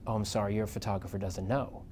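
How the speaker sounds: hushed aside. Retold or imitated. [name] quietly warning the reporter, hushed aside. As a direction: tell him quietly. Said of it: "she said".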